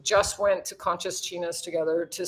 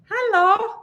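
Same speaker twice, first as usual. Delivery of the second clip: much higher, bright sing-song greeting; excited hello; 12 semitones higher, 4 dB louder than usual. Real, not acted. Greeting her friend Simone on stream with a much higher, excited hello.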